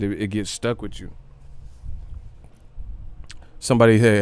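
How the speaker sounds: usual speaking voice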